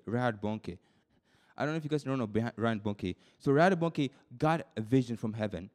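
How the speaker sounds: normal talking voice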